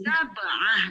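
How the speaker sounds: "Sab'ah" with an Arabic ain after the b. Shown as a demonstration. Teacher models number seven, ain in "Sab'ah". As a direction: say "Sab'ah" with the throat-squeezed ain before "ah". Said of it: "dengan ain lagi".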